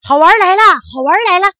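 high, very loud calling; shouted announcement. A narrator's reading. Old monkey summoning all the monkeys, shouting excitedly. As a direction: holler to gather everyone. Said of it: "老猴子这么一喊".

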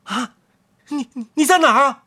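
where is that voice; puzzled thief. Acted. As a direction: thief looking around for the tiny speaker. Thief searching for hidden voice.

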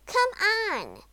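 high, loud impatient urging; impatient call. A narrator's reading. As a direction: call out as a fed-up wife. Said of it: "called his wife impatiently".

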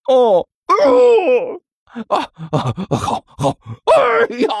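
broken high moans and yelps; moan, then yelp high in pain; pained wailing. Acted. King's burned paw stamped out, wailing in pain.

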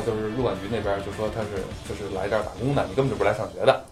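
normal talking voice

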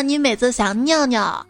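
normal talking voice

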